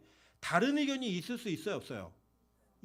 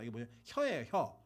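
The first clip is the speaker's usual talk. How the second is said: usual speaking voice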